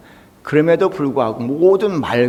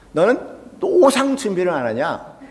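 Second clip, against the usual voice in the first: high-pitched reproachful question; mock telling-off, pitched up; retold teacher scolding; 6 semitones higher than usual. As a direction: scold a pupil as an exasperated teacher. Acts out teacher scolding him.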